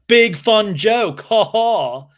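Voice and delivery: loud, high and drawn out; mock sarcastic outburst. Recapper imitating James after the joke, loudly sarcastic. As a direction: imitate an angry man's sarcastic fake laugh.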